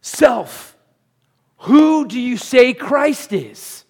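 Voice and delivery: high, loud, slow question; emphatic self-address. Acted. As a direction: drive the question home to yourself. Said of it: "All of us need to daily remind ourselves".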